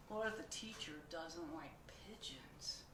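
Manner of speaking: sharp gasp then a hushed worry; gasp, then whisper the fear; fearful gasp and whisper. Acted. Pigeon fears the teacher, gasp then whisper.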